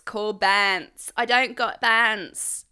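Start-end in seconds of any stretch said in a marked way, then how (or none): 1.2-2.6 put-on slow London geezer accent; a hint of a London geezer accent. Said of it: "now I'm in my geezer voice".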